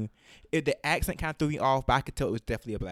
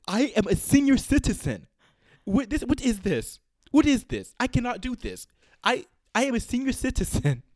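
put-on loud, high, indignant Jamaican-accented caller; imitated Jamaican accent; 12 semitones higher, 9 dB louder than usual. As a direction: shout it out as an outraged customer with a Jamaican accent. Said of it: "I could do it the way he said it".

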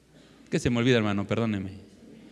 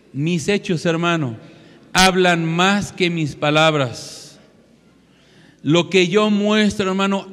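preaching at full force; 3 semitones higher, 6 dB louder than usual. Acted. Preacher pressing the congregation, slow and loud. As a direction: preach each word slowly and loudly.

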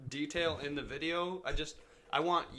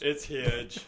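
Donald Trump impression; 9 dB louder than usual. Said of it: "the Donald Trump impression".

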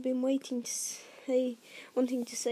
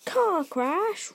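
outraged, loud roar; 6 semitones higher, 8 dB louder than usual. A narrator's reading. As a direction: roar it back in disbelief. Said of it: "roared Hagrid".

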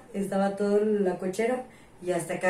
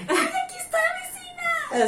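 very high put-on neighbour voice; mock neighbour; slower than usual. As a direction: pitch it way up, mimicking the neighbour.

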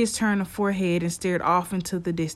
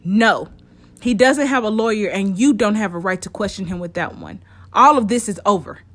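louder, rising to an angry order; scolding; 5 dB louder than usual. Acted. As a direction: scold them and throw them out. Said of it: "she scalded".

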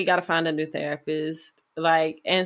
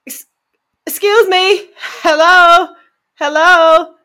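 loud, high hello to wake her; loud high calling out; 11 semitones higher, 11 dB louder, slower than usual. Retold or imitated. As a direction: call out sharply to a dozing therapist. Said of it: "I was like".

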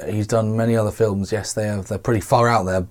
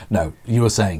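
usual speaking voice

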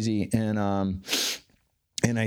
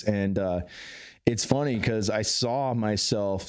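ordinary talk, nothing marked

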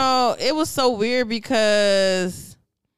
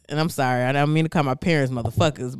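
usual speaking voice

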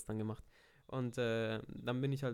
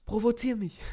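high, loud teasing dare; playful dare; 11 semitones higher, 8 dB louder than usual. Pretend. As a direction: egg him on, cheeky and loud. Teasing challenge to friend. (frech und laut herausfordernd)

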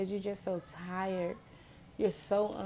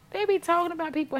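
higher, louder indignant repeat; 9 semitones higher, 7 dB louder, faster than usual. Retold or imitated. Repeats accusation, higher second.

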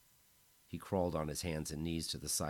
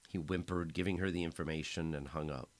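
plain reading voice

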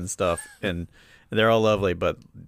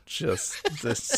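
ordinary talk

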